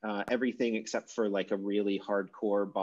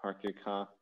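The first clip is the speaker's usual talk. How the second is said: Boston accent; 8 dB quieter than usual. Shown as a demonstration. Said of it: "the stereotypical Boston accent is deleting those syllable final R's".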